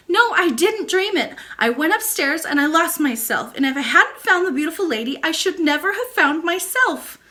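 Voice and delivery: high, eager little princess voice; a hurt girl pressing her case; child princess. Acted. Princess insists to her nurse, high and eager.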